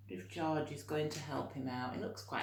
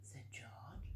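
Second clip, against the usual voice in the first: plain reading voice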